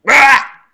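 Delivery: short loud yelp of frustration; sharp frustrated yelp. Real, not acted. Gamer failing again, loud yelp.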